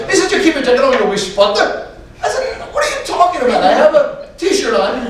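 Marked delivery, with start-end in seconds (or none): 0.3-1.9 high, imitated Donegal accent; baffling thick Irish brogue, played for laughs; northern Irish accent. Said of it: "Eamon Malloy was from the north of Ireland". 2.7-5.1 hammed-up comic exasperation; exasperated. Acted exasperation at being misunderstood.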